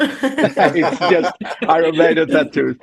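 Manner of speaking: laughing through the words, slowed; amused, chuckling it out. Real, not acted. Band member laughs about his tattoos.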